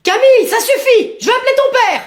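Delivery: high, exasperated parental threat; fed-up mother's high scolding. Acted. Mother scolds child refusing school.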